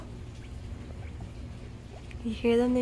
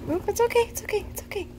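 high, gentle soothing; calm the frightened little one; 11 semitones higher, much faster than usual. Real, not acted. Calming a scared baby animal.

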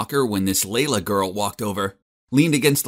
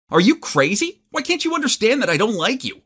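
higher, louder angry yell; yelled in her face; 12 semitones higher, 4 dB louder than usual. Retold or imitated. Felix yells at Layla at her lunch table, furious. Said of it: "yelled in her face".

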